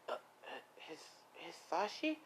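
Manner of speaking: stunned, soft questioning stammer; startled stammer. Acted. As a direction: stammer out the name as a rising question. Startled wife sees her husband.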